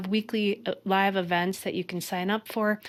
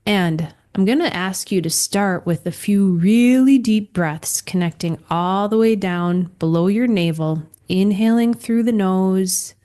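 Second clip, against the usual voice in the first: guided breathing; 5 dB louder than usual. Acted. Leads breathing instructions.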